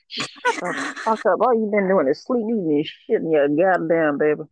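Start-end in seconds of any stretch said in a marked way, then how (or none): none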